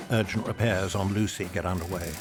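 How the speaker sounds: ordinary talk, nothing marked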